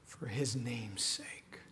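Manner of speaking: slow whispered close. Real, not acted. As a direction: let it fade to a breath. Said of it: "whispered closing verse".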